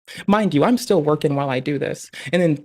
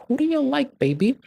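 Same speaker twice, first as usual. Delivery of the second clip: mom asking sweetly; 9 semitones higher than usual. Retold or imitated. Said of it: "my mom would look at me and be like".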